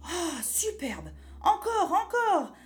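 put-on high child's cheer growing loud; jubilant. Boy eggs on the quarrelling witches, jubilant. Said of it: "applaudit Pierre en jubilant".

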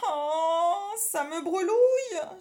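put-on a boy squirming in discomfort; writhing whimper. A narrator's reading. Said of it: "se tordit-il".

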